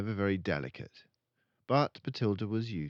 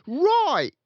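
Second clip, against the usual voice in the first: put-on very high, loud boy's voice; eager son; 12 dB louder than usual. Son Michael agreeing with father, eager boy voice. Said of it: "the son said".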